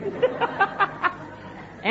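high, breathy burst of laughing; real high-pitched chuckling; laughter. Real, not acted. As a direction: laugh out loud at your own joke. Preacher laughs at own joke.

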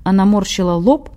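plain reading voice

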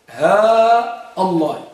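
slow teaching demo, long "ها" before a separate "الله"; long vowel and glottal stop. Shown as a demonstration. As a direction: hold "ها" very long, then start "الله" afresh with a hamza. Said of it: "بالمد والقطع". («ها» ممدودة ثم «الله» بهمزة مقطوعة)

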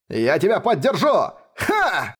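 battle cry with a sharp shout. Acted. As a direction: yell it as you charge in. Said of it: "закричал обладатель кланового гарпуна".

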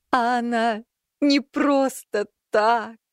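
high, slow wailing cat. A narrator's reading. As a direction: wail it out like a crying cat. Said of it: "завыл с той стороны говоривший". (протяжный вой сквозь слёзы)

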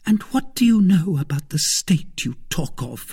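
high, dismissive woman's voice; scornful wife. Acted. Wife belittling her husband's politics, scornful. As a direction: scoff at his pretensions.

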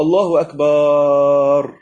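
"الله أكبر" very slow, long drawn vowel, loud; wrong stretched takbir. Shown as a demonstration. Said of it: "ما ينفعش نقول".

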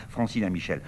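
usual speaking voice

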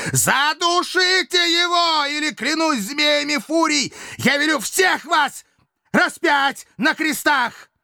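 very high, drawn-out threatening shriek; terrible scream. A narrator's reading. Dictator threatens hesitating slaves with crucifixion, terrible screaming. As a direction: summon your last strength and scream the threat. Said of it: "закричал страшным голосом".

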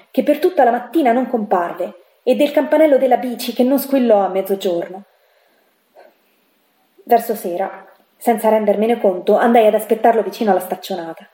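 ordinary delivery

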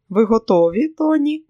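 lightly flirty, pitch lifting high on the name; coquettish. A narrator's reading. Lucy smiles at Tony before leaving, coquettish. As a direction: ask with a playful upward lilt. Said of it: "кокетливо посміхнулася Люсі".

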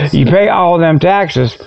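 ordinary talk

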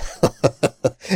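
quick breathy chuckle; a brief amused laugh; light unvoiced laugh mid-sentence; short laugh. A narrator's reading. Friends teasing each other.

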